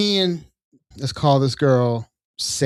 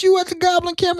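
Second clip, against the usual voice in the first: much higher squeaky goblin question. Retold or imitated. Goblin asking the barbarian about the camp, high squeaky voice. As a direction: pitch it way up like a little goblin. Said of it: "it's like".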